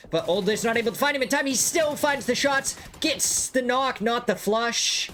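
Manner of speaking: high, fast hyped-up commentary; excited play-by-play. Real, not acted. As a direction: call the knock like a big moment. Caster hypes a sudden knock.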